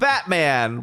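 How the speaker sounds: ordinary talk, nothing marked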